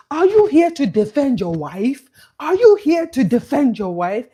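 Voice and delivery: loud, high mock challenge; indignant mom. Mom challenging Eddie as he comes over, indignant. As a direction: imitate an outraged mother demanding an answer.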